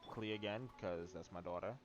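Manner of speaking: normal talking voice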